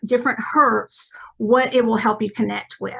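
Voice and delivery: ordinary talk, nothing marked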